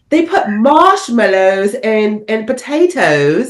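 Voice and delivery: imitating British kids at an American dinner; hammed-up British accent; mock British accent. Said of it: "They're like".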